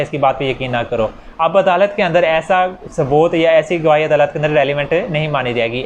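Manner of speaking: normal talking voice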